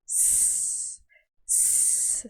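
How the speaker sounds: a long voiceless hiss, very soft. Shown as a demonstration. Teacher demonstrates the Spanish s to students. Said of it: "ela tem som de".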